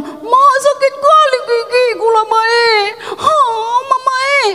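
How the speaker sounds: high, whiny mock-crying child; child complaining to mama. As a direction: wail to mama like a hurting child. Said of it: "ngadunya sama mamanya, kan? Bilang".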